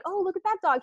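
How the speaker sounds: high, eager dog spotting a friend; excited dog voice. Retold or imitated. Adolescent dog spotting another dog, high and eager. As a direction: gasp at the other dog with delight. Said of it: "it's like".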